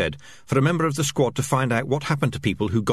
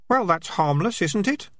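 lightly raised voice for Keith; a touch higher, breezy question; 11 semitones higher than usual. Acted. Keith brushing it off to the rat, lightly higher.

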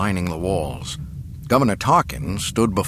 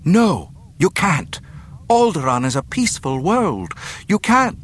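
higher, louder desperate protest; alarmed pleading; 10 semitones higher, 4 dB louder than usual. Acted. Princess begging for her home planet, desperate protest. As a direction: plead urgently against the threat.

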